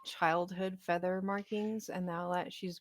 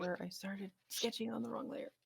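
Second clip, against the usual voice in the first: whispering; 10 dB quieter, faster than usual. Real, not acted. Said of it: "unvoiced whisper".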